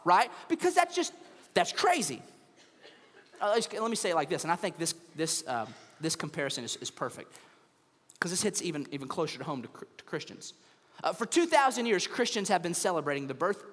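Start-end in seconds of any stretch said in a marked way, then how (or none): none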